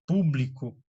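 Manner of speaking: "público" stressed as PÚ-bli-co; stress on first syllable. Shown as a demonstration. Teacher models an example, stress on PÚ of "público". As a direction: lean on PÚ in "público", let the rest fall. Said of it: "sílaba tônica é a antepenúltima".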